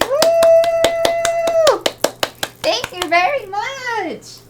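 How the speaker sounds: very high whoop into drawn-out thanks; celebratory thanks. Real, not acted. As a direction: squeal with gratitude, slowing on the thanks.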